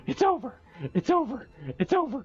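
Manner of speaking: very high-pitched, disbelieving squeal; overwhelmed high squeal. Real, not acted. Streamer ending a two-thousand-encounter hunt, high overwhelmed squeal. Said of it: "I gotta watch my voice".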